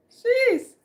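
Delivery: overwhelmed, startled exclamation; a shocked high jeez; high surprised jeez. Real, not acted. Overwhelmed by heat.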